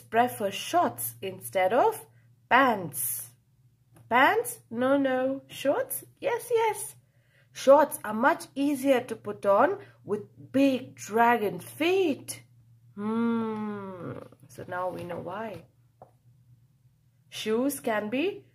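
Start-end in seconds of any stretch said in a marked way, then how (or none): none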